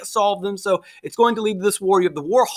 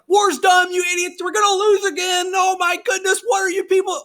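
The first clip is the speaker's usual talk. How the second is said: mock panicked rant; 10 semitones higher than usual. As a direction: rant like a frantic Federalist. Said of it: "remember, like".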